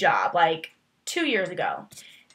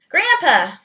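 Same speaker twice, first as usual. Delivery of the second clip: a playful shout of Grandpa, higher and louder; mock call out; 6 semitones higher, 7 dB louder than usual. Greeting people with a movie quote: playful shout. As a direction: call Grandpa out loud for fun. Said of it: "I'm like".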